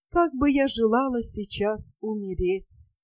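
ordinary delivery

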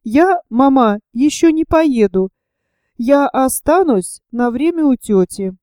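ordinary delivery, nothing marked

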